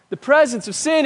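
ordinary talk

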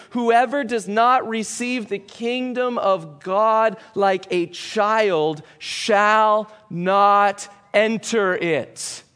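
very slow, each word weighted, stress on "not"; drawn-out "whoever does not receive", with "not" leaned on; solemn slow emphasis. Shown as a demonstration. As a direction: hammer home "shall not enter it" like a verdict. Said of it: "words that Jesus uses to emphasize".

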